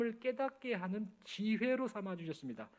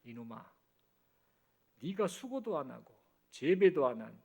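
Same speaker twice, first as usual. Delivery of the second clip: God rebuking Jonah; 7 semitones lower than usual. A narrator's reading. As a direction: gently scold the sulking prophet, much lower. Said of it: "하나님께서 요나에게 말씀하셨습니다".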